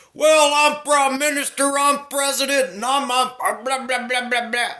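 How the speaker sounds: high, loud mocking voice; mocking self-important leaders. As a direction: mimic pompous politicians way up high, ending in blahs. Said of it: "these cocksuckers think".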